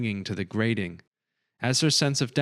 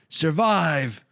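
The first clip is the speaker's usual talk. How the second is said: a loud, higher yell with a falling end; desperate yell; 8 semitones higher, 5 dB louder than usual. A narrator's reading. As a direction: yell the word out as a farewell. Reginald's last plea.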